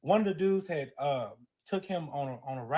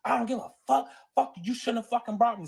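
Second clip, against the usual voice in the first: raised, higher-pitched cussing, mimicking the boyfriend; defiant anger; 6 semitones higher, 4 dB louder, faster than usual. Boyfriend refusing to stop embarrassing partner, defiant anger. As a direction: act out an angry refusal to back down.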